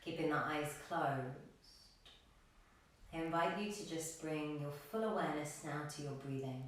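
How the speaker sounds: soft, low-key yoga meditation voice with long pauses; guided meditation. Acted. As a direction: gently guide the class into stillness, leaving room to breathe. Leads a breathing meditation.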